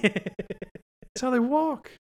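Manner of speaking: very high, very slow, drawn out; slow exasperated squeal. Real, not acted. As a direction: stretch it in high disbelief. Exasperated outburst at penguin logic.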